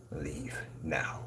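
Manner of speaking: low whispered order to go; very soft, low, final command; hushed dismissal. Real, not acted. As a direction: send them off in a near whisper. Dismissing rivals after scolding.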